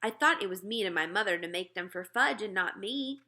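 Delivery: ordinary delivery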